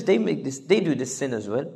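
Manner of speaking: low, soft, persuasive murmur; mock whisper. Devil excusing sin as common, in a mock whisper. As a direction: drop the voice to make it sound like no big deal. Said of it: "He will whisper".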